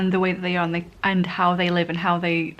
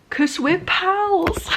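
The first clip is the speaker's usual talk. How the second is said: much higher, slow, mocking dumb-guy voice on "'Cause we're pals"; mock Kensuke voice; 11 semitones higher, much slower than usual. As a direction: squeak it out as a clueless bro. Voicing Kensuke in a quote.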